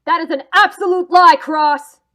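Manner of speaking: loud angry retort; indignant. Acted. Accused man snaps back at the detective, angry. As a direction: hurl the denial at him.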